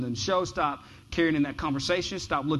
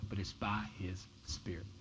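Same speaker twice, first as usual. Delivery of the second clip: hushed, breathy whisper of the key line; 6 semitones lower, 12 dB quieter than usual. Acted. Pastor to congregation, repeating the verse in a whisper. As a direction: lean in and whisper it like a secret.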